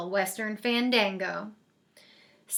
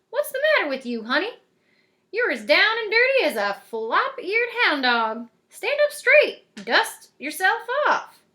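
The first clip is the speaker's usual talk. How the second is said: put-on bossy old cowgirl; 6 semitones higher, 5 dB louder than usual. A narrator's reading. Godmother ordering Cindy to stand up straight, high and loud.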